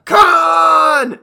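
huge dramatic shout; Khan yell. Pretend. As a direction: scream it like Kirk. Star Trek Khan yell parody.

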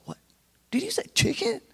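very high, soft incredulous question; amazed disbelief. Retold or imitated. Hungry boy hearing of chicken, amazed disbelief. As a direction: squeak it in hushed disbelief.

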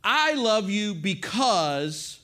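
very slow, each word spaced out; measured, meaningful build towards BECAUSE; deliberate declaration. Shown as a demonstration. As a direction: spell out the sentence starter slowly, leaving it open. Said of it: "this is what you say".

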